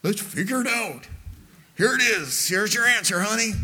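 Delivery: lightly acted husband handing over the answer; fix-it husband. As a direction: imitate an over-eager problem-solver. Husband offering wife a quick fix.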